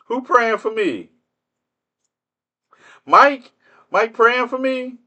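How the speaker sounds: high-pitched incredulous questions; mock disbelief. Streamer hears a heckler will pray for him, mock disbelief. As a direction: act astonished, pitch way up.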